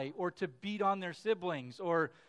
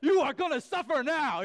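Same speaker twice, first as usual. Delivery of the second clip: higher and louder hammed-up menace; mock vengeful threat; 7 semitones higher, 11 dB louder than usual. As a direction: play a dad pretending to lose it and swear revenge. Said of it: "everything in me just wanted to be like".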